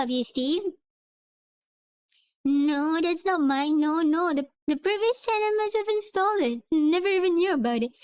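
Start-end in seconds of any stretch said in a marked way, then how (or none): 2.4-7.9 cowardly voice. Acted. Steven denying the safe to Calloway, cowardly. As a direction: stammer out excuses like a coward. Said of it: "the most cowardly voice that I can".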